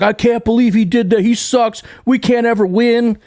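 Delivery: mock the moaning fans in his feed; whiny fan. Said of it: "my whole feed is filled with".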